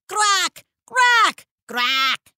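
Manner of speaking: high, loud attack caws; fierce crow cry. Acted. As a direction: squawk at the guards.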